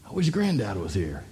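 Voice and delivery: low, soft wistful sigh of a line; mildly acted sadness, hushed; wistful longing. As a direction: drop soft and low, missing someone. Acting out missing a late granddad.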